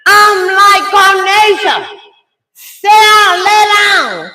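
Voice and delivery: high, loud mock shout at her daughter; loud, high-pitched scolding retelling; acted yelled order. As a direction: re-enact a mother hollering a command. Mother yelling orders at daughter.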